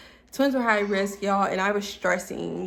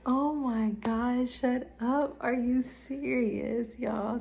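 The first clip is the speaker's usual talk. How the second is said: higher, slow, wide-eyed disbelief; stunned disbelief; 4 semitones higher, slower than usual. Retold or imitated. Mother seeing twins on the ultrasound screen, stunned disbelief. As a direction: gasp it out like you can't believe it.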